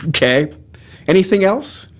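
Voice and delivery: ordinary talk, nothing marked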